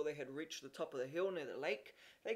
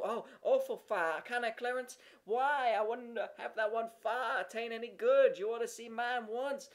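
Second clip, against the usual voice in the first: put-on higher, louder boyish boasting; bragging boy; 4 semitones higher, 4 dB louder than usual. A narrator's reading. Jimmy bragging to Horace, raised boyish voice. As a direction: outdo the other kid.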